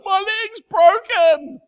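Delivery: put-on high, slow, wailing child; hammed-up child howling about a broken leg; whiny hurt kid. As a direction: wail it like a melodramatic little kid. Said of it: "and the kid was like".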